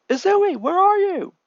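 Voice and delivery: high, loud desperate call; yelling. A narrator's reading. Brother searching for his sister, yelling. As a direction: shout her name into the dark. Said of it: "runs towards the voice yelling".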